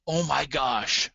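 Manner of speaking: slow, hammed-up wonder; acted excitement. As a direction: play an excited listener, stretching it out. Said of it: "what someone who's excited may be saying".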